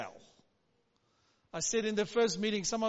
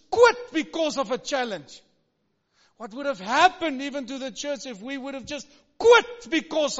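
full-force preaching; 4 semitones higher, 9 dB louder than usual. Acted. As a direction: proclaim it to the congregation. Preaching climax.

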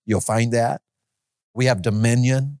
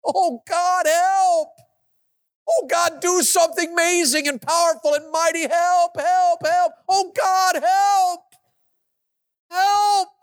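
fervent pleading; slower than usual. Shown as a demonstration. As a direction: plead desperately to God. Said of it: "pray fervently".

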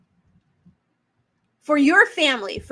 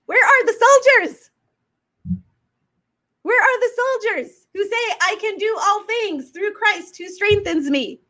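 rallying cry; 6 semitones higher than usual. Acted. Calling out for spiritual soldiers.

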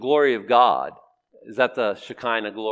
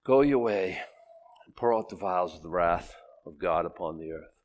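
much lower and softer, sorrowful Go your way; brooding God; 7 semitones lower, 6 dB quieter than usual. Retold or imitated. God sending the angels, low and brooding. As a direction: say it heavily, grieving.

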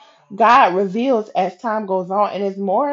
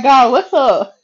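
loud, higher playful call; joking demand; 5 semitones higher, 7 dB louder, slower than usual. Retold or imitated. Jokingly calling on God for answers, playfully demanding. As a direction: call out impatiently, on the edge of laughter.

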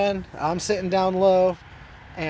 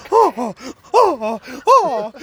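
pained moaning; 7 semitones higher, 8 dB louder, slower than usual. Retold or imitated. Imitates injured friend moaning.

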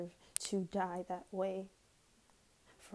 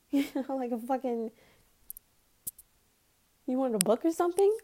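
fast, loud and higher ridicule; mocking scorn; 5 semitones higher, 8 dB louder, faster than usual. Real, not acted. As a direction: sneer at his motives. Mocking the president's motives.

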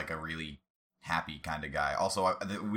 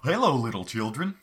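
higher, louder gleeful clown greeting; bouncy gleeful greeting, a bit louder; gleeful shout; 5 semitones higher, 6 dB louder than usual. Acted. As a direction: call out to the kids with glee. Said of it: "I shouted with glee".

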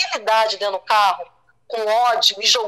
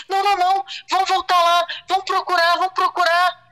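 higher-pitched, pleading desperation; desespero recontado; 6 semitones higher than usual. Retold or imitated. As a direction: act out desperately insisting on going back. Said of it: "desesperada, eu falei assim". (desespero encenado, voz mais aguda)

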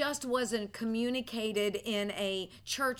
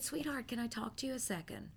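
gentle; 8 dB quieter than usual. Retold or imitated. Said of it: "And I said".

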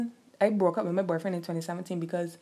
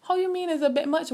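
high, pitched-up outraged quote; indignant retort; 9 semitones higher than usual. Retold or imitated. Retelling the roommate fight, a high indignant comeback. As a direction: throw it back at her in disbelief. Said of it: "I said".

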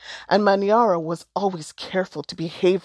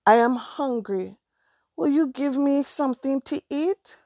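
put-on higher, pleading child voice; a small boy asking for food; hungry small boy; 7 semitones higher than usual. A narrator's reading. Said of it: "said the boy".

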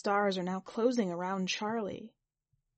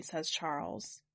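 ordinary delivery

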